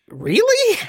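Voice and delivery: very high-pitched, incredulous squeal; overreacting outburst. Reacting to the cigar seller's supposed pharmacy tip, shocked outburst. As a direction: leap way up in disbelief. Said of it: "I felt embarrassed by my outburst".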